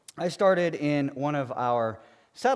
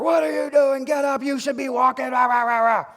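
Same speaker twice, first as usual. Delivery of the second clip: much higher, louder barking shout; yelling drill instructor; 13 semitones higher, 8 dB louder than usual. Retold or imitated. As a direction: tear into the recruit like a drill sergeant. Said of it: "he starts laying into me".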